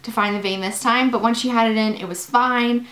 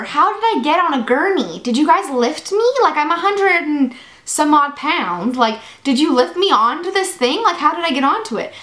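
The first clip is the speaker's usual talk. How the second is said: higher, insistent retold questioning; mock-urgent, raised-pitch questions; 5 semitones higher than usual. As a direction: act out demanding an answer from the nurse. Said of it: "I'm, like, very insistent".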